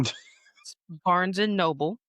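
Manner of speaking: BARNES AND NOBLE drawn out slowly; emphatic correction. Real, not acted. Correcting family's pronunciation, drawn out.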